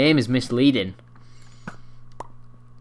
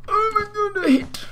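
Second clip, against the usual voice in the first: mock squeal, extremely high-pitched; exaggerated falsetto celebration; imagined excited reaction. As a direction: squeak out pretend amazement. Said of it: "I would have been like".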